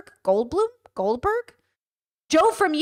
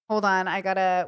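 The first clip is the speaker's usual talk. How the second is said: ordinary talk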